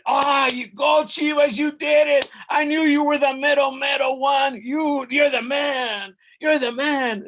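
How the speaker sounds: high, loud jubilant shouting; overexcited. A narrator's reading. Lord of Mictlán praising the hero, overexcited. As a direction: cheer him on at full voice. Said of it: "gets overexcited".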